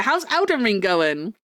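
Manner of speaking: usual speaking voice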